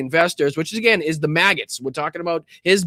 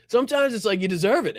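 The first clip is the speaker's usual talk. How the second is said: ordinary talk, nothing marked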